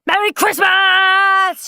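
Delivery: loud, high, stretched-out Christmas shout. Acted. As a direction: yell it to wake the house. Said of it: "shouted Henry".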